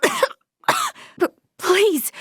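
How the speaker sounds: jumpy high stammer; startled stammer. Acted. Startled by Leticia sneaking up, high stammer. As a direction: caught off guard, stumble out the plea.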